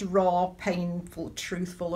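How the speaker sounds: slow, weighty raw, painful; slow emphasis. Real, not acted. Preacher stressing the friend's honest opinion, slowly. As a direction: lean on each word slowly.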